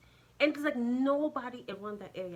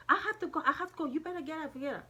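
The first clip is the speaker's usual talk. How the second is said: raised, high voice imitating a fed-up driver; driver's impatient insistence; 7 semitones higher, 3 dB louder, faster than usual. Acted driver insisting passenger leave.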